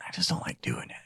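sheepish whisper. Real, not acted. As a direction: mutter it under your breath. Whispered reluctance.

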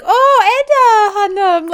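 high, loud, drawn-out squeal of greeting; mock-delighted call to someone just spotted; gushing greeting. Mimics Denise spotting Edda joining live.

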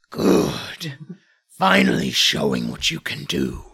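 stern warrior voice. Acted. GM voices enemy NPC.

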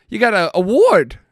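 light New Jersey twang on "award"; New Jersey accent. Retold or imitated. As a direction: slip into a New Jersey accent mid-question. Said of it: "slipped into New Jersey".

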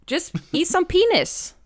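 high, loud mock outburst; loud mock retort. To Emil, a high loud mock retort. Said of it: "I thought".

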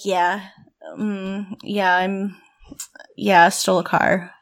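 put-on low, hesitant broody teen mumble; mock Ryan's mumbled confession. Friend mimics the brooding teen's slow confession, low and mumbling. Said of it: "so dramatic and broody".

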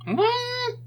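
very high, squeaky meme "What?"; doing the meme. Pretend. A cohost does the meme in a squeaky high voice. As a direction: shriek it like the viral meme clip. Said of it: "I'm doing the meme".

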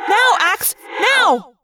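high, sharp yell. A narrator's reading. Rachel to Axe, yelling the cue. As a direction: time the dodge for him. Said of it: "I yelled".

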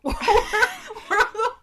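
high, slow words broken by laughter; laughing. Real, not acted. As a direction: crack up mid-word. Repeats name, breaks up laughing.

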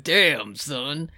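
normal talking voice

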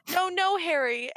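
hammed-up panicky aunt begging; desperate screech. Aunt begging Harry to stay, mock screech. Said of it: "Aunt Petunia screeched desperately".

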